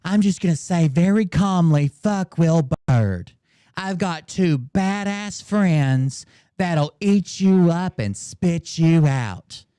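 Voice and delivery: slow, drawn-out mock tough-guy threat; slow mocking imitation. Mocking the streamer's calm threat, slow and drawn out. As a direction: stretch every word like a fake hard man. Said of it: "all this side-eyed bullshit about".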